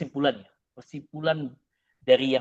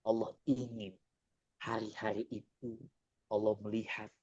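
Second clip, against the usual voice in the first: voicing Allah softly; 4 semitones lower, 6 dB quieter than usual. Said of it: "kata Allah".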